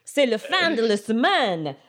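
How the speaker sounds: high, huffy French protest; mock indignant French. Friends retelling, mock French outrage. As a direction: play the offended Parisian purist. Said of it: "They're like".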